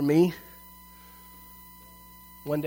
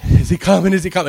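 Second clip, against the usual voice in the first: loud anxious asking; nervous check; 4 semitones higher, 10 dB louder, faster than usual. Retold or imitated. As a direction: glance in the mirror, worried.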